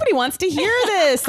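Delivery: mock-prim inner scolding. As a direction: chide her like a prim aunt. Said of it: "and I thought".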